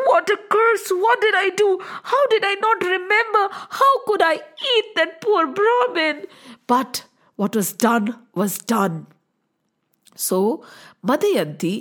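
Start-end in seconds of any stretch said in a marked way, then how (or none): 0.1-6.3 remorseful king. Acted. King's remorse voiced in story.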